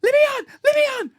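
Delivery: high, loud frantic pleading; panicked cry. Retold or imitated. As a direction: beg in a squealing panic. Panicked swimmer begging onto boat.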